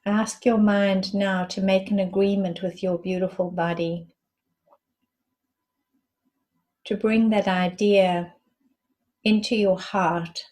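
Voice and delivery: measured meditation guide, long silences between phrases; guided meditation. Acted. Guide asking listeners to breathe and rest into the space, calm meditation voice. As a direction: lead them inward in unhurried phrases, letting each one settle.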